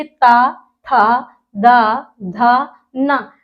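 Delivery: slow, deliberate dental ता था दा धा ना; teacher repeating the dental row; dental syllables. Shown as a demonstration. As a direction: say ता था दा धा ना slowly, tongue touching the teeth. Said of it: "अब इनको आप बोल के देखिए".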